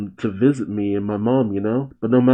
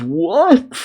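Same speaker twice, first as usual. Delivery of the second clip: high startled what; surprised; 9 semitones higher than usual. Acted. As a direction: jolt up in surprise. Guy surprised shout.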